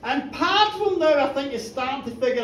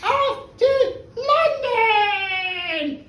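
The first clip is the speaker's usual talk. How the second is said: high, drawn-out cry, last word held long; high theatrical shout; 8 semitones higher, 3 dB louder, much slower than usual. Acted. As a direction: call it out like a spell, stretched slow. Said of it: "She shouts out".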